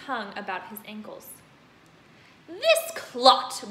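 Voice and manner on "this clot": booming, furious headmistress. Acted. Headmistress booming at the boy. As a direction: bellow it at the boy. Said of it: "boomed the headmistress".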